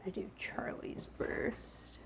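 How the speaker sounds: slow whispered aside; whispering. Real, not acted. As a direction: whisper it to yourself. Whispering aside while cooking.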